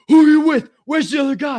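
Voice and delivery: high, loud mock cop demand; cop yelling. Cop questioning him at the car, high loud demand. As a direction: demand answers fast.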